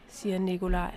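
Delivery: ordinary delivery, nothing marked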